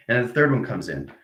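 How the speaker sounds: normal talking voice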